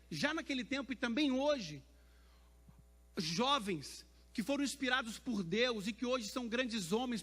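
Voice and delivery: loud, high, forceful sermon delivery; impassioned preaching. Real, not acted. Preacher testifying about the young men in church, impassioned. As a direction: proclaim it to the whole church with rising fervour.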